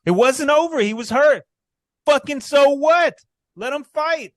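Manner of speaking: high, loud outraged yelling at the ref; real yelling. Real, not acted. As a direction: yell it out, furious the fight was stopped. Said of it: "I'm yelling into a mic".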